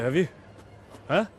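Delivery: usual speaking voice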